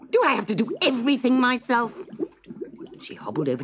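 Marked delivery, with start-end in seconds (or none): 0.1-2.0 angry witch snap. Acted. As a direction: spit out the insult at the girl. Witch scolds Gretel.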